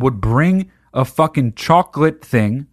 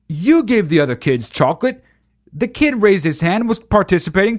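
raised, heated scolding; indignant rant; 6 semitones higher than usual. Real, not acted. Ranting at the church people.

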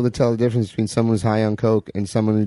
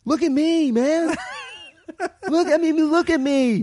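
very high, wild crazy-guy voice; crazed guy voice. Pretend. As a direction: squeal it like a wide-eyed crazy man. Voicing a crazed character.

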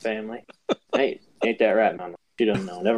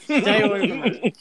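protective outburst; 6 semitones higher than usual. Acted. Protective son shouting at rival.

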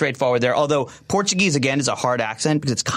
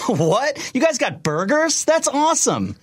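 put-on much higher, excited American young guy; bubbly teen footballer delighted by burgers; Pulisic voice; 13 semitones higher, slower than usual. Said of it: "Definitely my favorite to voice".

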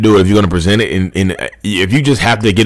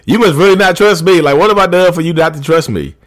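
feigned indignation; 7 semitones higher than usual. Acted. Acted gaslighting, blaming the partner.

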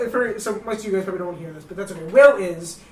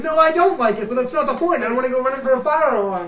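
mock indignant guard, higher; 6 semitones higher, 6 dB louder than usual. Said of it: "He goes".

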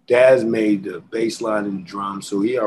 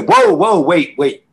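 high yelled whoa to stop him; mock shout; 6 semitones higher, 9 dB louder, slower than usual. Snoop stopping the rapper mid-verse, high yelled whoa. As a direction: holler to cut the rapper off. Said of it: "dogs say".

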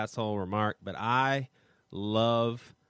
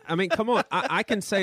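exasperated, raised voice; heated; 8 semitones higher than usual. Real, not acted. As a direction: push back hard, frustrated. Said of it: "I told you it's gonna get heated".

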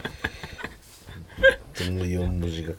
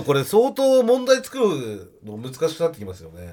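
ordinary delivery

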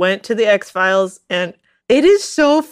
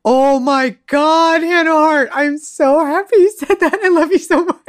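gushing agreement; 4 semitones higher than usual. Real, not acted. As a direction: gush with heartfelt relief, stretching the words.

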